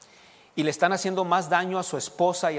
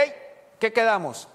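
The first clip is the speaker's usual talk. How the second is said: higher, firm call to order; raised voice; 6 semitones higher than usual. Acted. Father calling his family to order in a raised voice. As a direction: raise your voice without yelling. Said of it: "tengo que levantar la voz".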